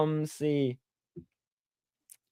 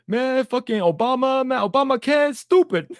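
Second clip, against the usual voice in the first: mock angry dad; 9 semitones higher, 4 dB louder than usual. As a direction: imitate dad fuming about Obama. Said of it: "He got mad over that".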